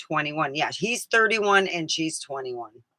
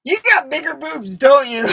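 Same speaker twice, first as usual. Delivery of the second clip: teasing one-liner; 11 semitones higher, 8 dB louder than usual. Retold or imitated.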